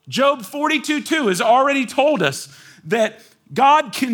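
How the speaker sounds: high, loud, full-force sermon voice; fervent pulpit delivery, pushing the pitch up; impassioned preaching. Acted. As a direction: preach it to the back row.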